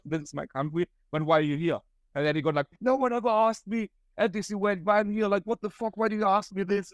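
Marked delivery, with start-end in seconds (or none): 2.8-6.9 higher-pitched, indignant mock complaint; put-on indignation, voice raised in pitch; retold anger. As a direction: imitate an offended guy griping. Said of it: "really super angry".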